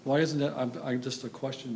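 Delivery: usual speaking voice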